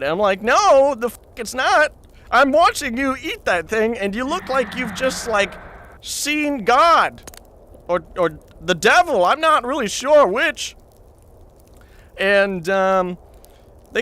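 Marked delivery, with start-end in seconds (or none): 0.4-10.7 raised, high-pitched, incredulous protest; exasperated refusal. Retold or imitated. To buddies pushing the pepper on him, retold exasperated refusal. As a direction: refuse loudly, acting out disbelief.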